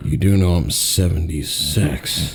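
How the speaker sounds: lightly voiced aging hero, dragging it out; mock Harrison Ford.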